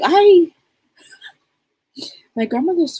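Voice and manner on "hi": bright greeting. Retold or imitated. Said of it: "Nana's like".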